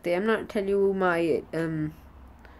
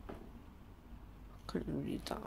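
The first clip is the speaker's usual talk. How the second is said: whispered to himself, slow; muttered whisper; 3 semitones lower, 21 dB quieter, slower than usual. Real, not acted. Boy can't read his own question card, whispering. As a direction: breathe it out, puzzled by the note.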